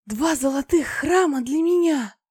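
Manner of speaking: high, loud ecstatic exclamation; blissful delight. A narrator's reading. Goddess picturing two golden temples of her own, blissful cry. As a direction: gush as if in paradise. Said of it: "она была в нирване".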